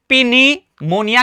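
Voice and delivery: पिनीमोनिया with the p sounded; wrong pronunciation. Shown as a demonstration. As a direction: add a pi- syllable before the n in पिनीमोनिया. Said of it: "wrong हो जाएगा". (पिनीमोनिया में 'प' बोलकर गलत उच्चारण)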